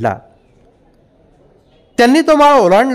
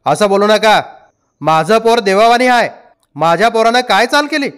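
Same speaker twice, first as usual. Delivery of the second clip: indignant higher-pitched protest; heated, raised voice; 6 semitones higher than usual. A narrator's reading. Father defending his son in a quarrel, heated protest. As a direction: defend your boy hotly. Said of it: "तावातावानं पुढं सरसावला आणि मोठ्यानं म्हणाला".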